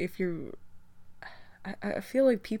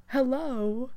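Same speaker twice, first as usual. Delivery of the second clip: incredulous hello; 6 semitones higher than usual. Acted. Mock disbelief at mom-bashers.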